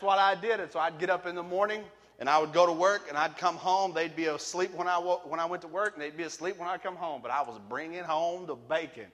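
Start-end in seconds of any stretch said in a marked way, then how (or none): none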